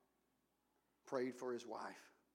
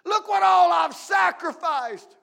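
slow, much higher and much louder brag; mock boastful shout; 23 dB louder, slower than usual. Preacher mocking believers boasting of sacrifice, shouted. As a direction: shout it out like a braggart.

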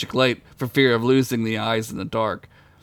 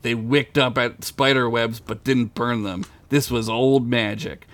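ordinary talk, nothing marked

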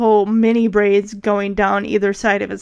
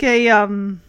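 ordinary talk, nothing marked

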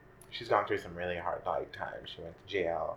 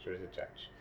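softer repeat; 8 dB quieter than usual. Real, not acted. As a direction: drop to a quiet aside. Quieter repeat for emphasis.

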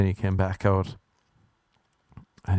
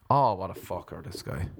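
muttered aside; 2 semitones lower, 6 dB quieter than usual. Retold or imitated. Annoyed at the visitor, to himself: soft mutter. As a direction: grumble it to yourself.

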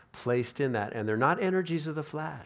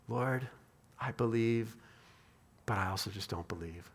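soft, halting prayer sinking lower; subdued confession; 4 semitones lower, 7 dB quieter than usual. Acted. Struggling father admitting doubt to Jesus, subdued confession. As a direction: confess it quietly with long pauses, fading at the end.

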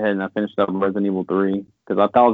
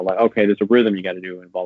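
ordinary talk, nothing marked

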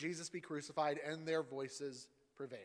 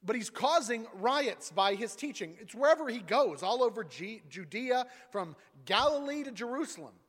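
insistent accusers; 7 semitones higher, 9 dB louder than usual. Acted. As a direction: push the charge hard against him. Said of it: "Then they became insistent".

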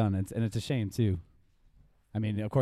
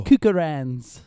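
put-on South African accent; 5 semitones higher, 10 dB louder, much slower than usual. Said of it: "rocking the South African accent".

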